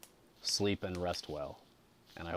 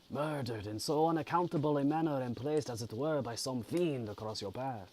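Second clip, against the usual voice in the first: higher-pitched, very bad French accent; Mister Kirwin; 6 semitones higher than usual. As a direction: sympathetic stranger in a clumsy put-on French accent. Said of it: "French accent, I guess?".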